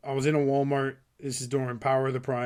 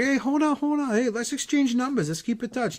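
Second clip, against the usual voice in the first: high-pitched, urgent imitation of a hustler; pushy seller; 10 semitones higher than usual. Seller stopping a shopper who walks away, urgently pushy. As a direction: call after a customer who is walking away. Acting seller chasing after him.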